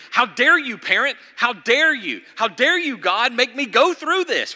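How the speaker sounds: shouted high and loud, outraged; angry shout. Retold or imitated. Child screaming at a parent, furious shout. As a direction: rage at the parent like a thrashing child. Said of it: "kicking and screaming, angry".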